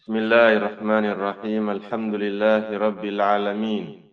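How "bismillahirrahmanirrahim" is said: ordinary talk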